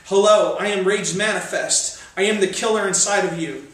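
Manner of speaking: roaring climax of the poem; peak rage. Acted. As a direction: explode on the final hello.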